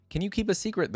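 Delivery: lightly voiced boy, pitched high; a hint of a high, chiming boy's voice; softly chiming boy. A narrator's reading. Mechanical boy asks Oriana to keep a secret, lightly chiming. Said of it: "His voice reminded Oriana of softly chiming bells".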